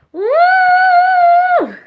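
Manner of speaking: loud high cheer; loud high woo. Real, not acted. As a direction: whoop for joy. Milestone cheer.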